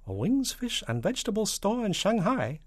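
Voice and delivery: high little Chinese shopkeeper voice; small distant voice. Acted. Shopkeeper answering the president's call, small high voice. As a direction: pipe it up high like a small phone voice. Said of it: "said a small, distant voice".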